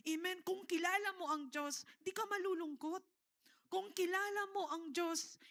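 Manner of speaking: ordinary talk, nothing marked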